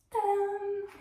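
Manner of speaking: high sung-out reveal; Tadam enjoué. Real, not acted. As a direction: announce it like a magic trick. Revealing shoes to camera. (révélation enjouée et aiguë)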